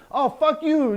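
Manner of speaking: high, loud mock snap at a troll; angry outburst. Shown as a demonstration. Activist showing the wrong reaction to a troll, a loud, high mock outburst. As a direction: blow up at the heckler, hammed up as the wrong way. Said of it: "react in some kind of an emotional way".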